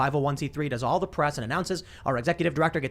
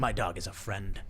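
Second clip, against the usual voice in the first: low murmur; 7 semitones lower, 7 dB quieter than usual. Retold or imitated. Inner thought voiced in retelling.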